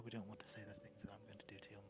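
hushed menacing whisper; creepy mock-sinister whisper; whispered threat. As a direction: breathe the threat softly.

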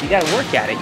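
ordinary delivery

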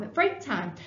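ordinary delivery, nothing marked

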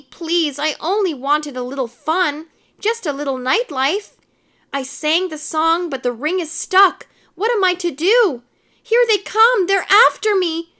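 building storybook desperation; desperate pleading. A narrator's reading. Anthony begs Strega Nona for help.

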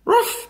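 high-pitched little dog yap; sharp, squeaky ruff; dog bark. A narrator's reading. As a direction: bark like an excited small dog. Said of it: "barked Ebb".

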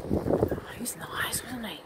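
hushed, whispery aside to the viewers; whispered aside. Real, not acted. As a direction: whisper it confidentially. Aside after the man leaves.

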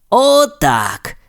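pleased, high-pitched exclamation; exclaiming. A narrator's reading. Steve breathing in the autumn air, exclaiming happily. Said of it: "вигукнув він".

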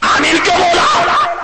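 high, drawn-out accusing yell; angry shout. Acted. Imam confronts worshipper over amen, angry shout. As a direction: hurl the question as an accusation.

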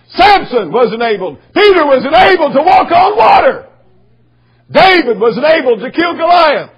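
loud, high shouted preaching; full-force preaching. Acted. Preacher shouting to his congregation, loud and high. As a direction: drive it home to the congregation.

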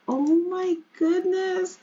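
slow, high Jamaican grandma; Jamaican accent. Pretend. Grandma seeing the baby first time, slow Jamaican accent. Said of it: "you know, they're Jamaican".

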